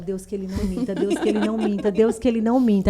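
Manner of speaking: half-joking anxious prayer; mock prayer. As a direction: pray it under your breath then rise. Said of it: "comecei a orar".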